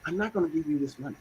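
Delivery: quick, quiet and matter-of-fact; direct refusal. Acted. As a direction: state the refusal directly, softly. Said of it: "I should really be direct and say".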